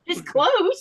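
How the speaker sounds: laughs through It's close; amused, laughing reply. Real, not acted. Reacting to the Swedish attempt, laughing.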